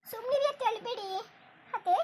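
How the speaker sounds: high, soft, tearful plea; small, upset comforting voice; gentle consoling. Acted. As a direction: hush her tenderly. Daughter-in-law consoles crying mother-in-law.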